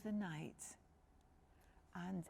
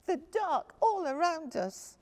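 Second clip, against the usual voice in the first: high, loud little cub voice; a small bear cub thanking sweetly; 10 semitones higher, 10 dB louder than usual. Acted.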